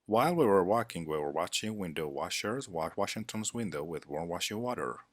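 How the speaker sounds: fast, crisply run-through tongue twister; quick-fire articulation exercise. Shown as a demonstration. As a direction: rattle it off quickly as a diction drill. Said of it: "Let's see more tongue twister".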